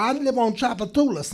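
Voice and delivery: imitated New Orleans local; New Orleans accent. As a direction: talk like a New Orleans native. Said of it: "this is the totally wrong accent".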